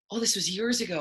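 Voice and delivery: ordinary delivery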